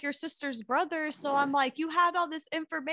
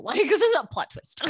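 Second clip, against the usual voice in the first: laughing through the words; cracking up mid-sentence; 9 semitones higher, 7 dB louder than usual. Real, not acted. Older sister plot twist.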